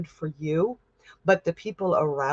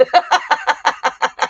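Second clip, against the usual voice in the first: burst of laughter; 8 semitones higher, 6 dB louder than usual. Real, not acted. Laughing at her own slip.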